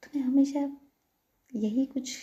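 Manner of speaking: acted indignant outburst; mock scolding. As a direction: scold a teasing partner. Acted scolding with a shoulder slap.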